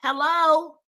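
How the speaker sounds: ordinary delivery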